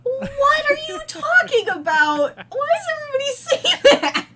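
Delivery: hammed-up Midwest protest, high and loud; Midwest accent. Co-host answers the host's introduction, exaggerated Midwest accent. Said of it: "accent ready to go".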